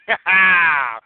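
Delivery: loud villainous cackle; gloating laugh. Real, not acted. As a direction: cackle in triumph over the rival. Rowdy fan's gloating laugh.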